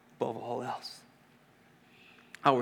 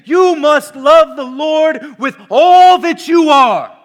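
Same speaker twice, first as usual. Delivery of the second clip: slow, loud with all stretched; stress on all; 11 semitones higher, 18 dB louder, slower than usual. Acted. Preacher voicing Jesus' demand, slow and emphatic. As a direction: lean hard on all. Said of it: "Jesus is saying".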